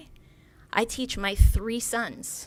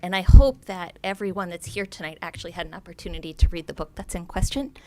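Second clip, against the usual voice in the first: usual speaking voice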